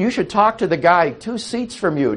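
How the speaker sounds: normal talking voice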